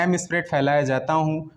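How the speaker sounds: ordinary delivery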